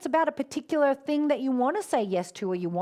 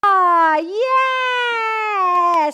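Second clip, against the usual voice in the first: excited cheer; 9 semitones higher, 12 dB louder, much slower than usual. Real, not acted. Announcing the prize winner excitedly.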